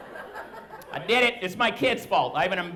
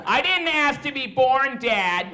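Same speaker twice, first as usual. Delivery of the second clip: higher-pitched mock teen outburst; angry teenager; 6 semitones higher than usual. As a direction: protest like an aggrieved teenager. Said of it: "till they're angry teenagers".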